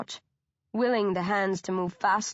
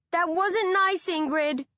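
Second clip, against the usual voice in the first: shouting; 9 semitones higher than usual. A narrator's reading. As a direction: shout up in a hurt reproach. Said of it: "Anya shouts".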